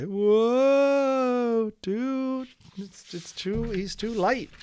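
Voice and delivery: high, wide-eyed whoa dude; a kid gasping as his friend sails up; surprised kid. Retold or imitated. Teacher voicing an amazed kid watching the brother float off, high and startled.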